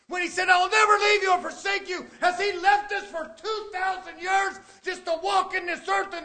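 loud, high shouted preaching; full-force preaching. Acted. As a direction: ring it out to the whole church. Long shouted sermon passage.

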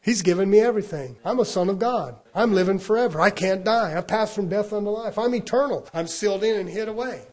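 loud, punchy declarations of faith; forceful preaching. Real, not acted. Preacher declaring himself a son of God, forcefully. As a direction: proclaim each statement like a creed.

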